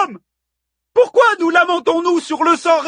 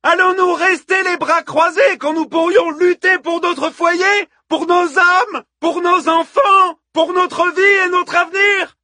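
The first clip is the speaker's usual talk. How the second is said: very high, loud war speech; bellowing; 5 dB louder than usual. A narrator's reading. Hippodamie invokes the Amazon queen to rally women, bellowing. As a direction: whip a crowd up to fight, full voice. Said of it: "beugla Hippodamie au sein du vacarme".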